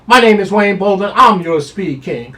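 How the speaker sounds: high, loud showman sign-off; sign-off catchphrase. Real, not acted. Handicapper signing off as the Speed King, loud and high. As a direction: announce yourself like a showman.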